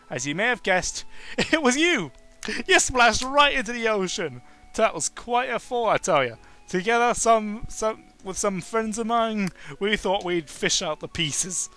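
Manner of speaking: a host bursting to share the news; excited storyteller. Acted. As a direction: tell the rescue like a thrilling tale. Richard excitedly recounts the rescue.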